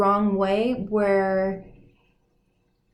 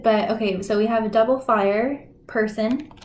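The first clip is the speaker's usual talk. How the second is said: usual speaking voice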